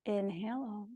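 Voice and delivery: inhale, then a faint breathy "O"; soft breath demo. Shown as a demonstration. Yoga teacher leading Ujjayi breathing, soft breathy demo. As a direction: breathe the O in, barely voiced. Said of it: "take two of these here".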